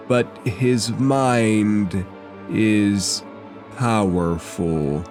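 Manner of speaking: slow, halting warning; grave, drawn-out pronouncement; ominous game figure. Acted. GM voices the figure warning players.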